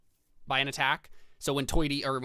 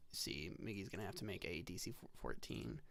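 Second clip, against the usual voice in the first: whisper; 7 semitones lower, 15 dB quieter than usual. Real, not acted. GM reading roll quietly.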